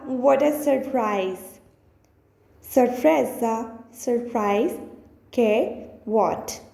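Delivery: ordinary delivery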